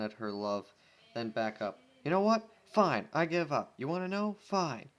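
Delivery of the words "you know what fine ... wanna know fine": loud, higher, fed-up surrender; exasperated outburst. Acted. Pony caught with her melon, exasperated. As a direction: throw up your hands and snap it out.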